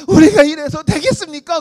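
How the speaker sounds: high, loud preaching cry; mock fiery preacher. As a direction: belt it like a revival preacher. Visiting pastor voiced preaching. (부흥 설교자 흉내, 높고 크게 외치듯)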